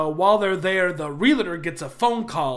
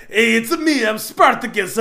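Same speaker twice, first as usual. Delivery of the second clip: put-on Mario accent; 4 semitones higher, 6 dB louder than usual. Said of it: "Mario accents".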